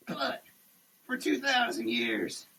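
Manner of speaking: ordinary delivery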